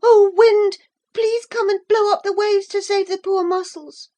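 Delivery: put-on high loud pleading boy call. A narrator's reading. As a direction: call out to the wind for help. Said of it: "he heard himself call out".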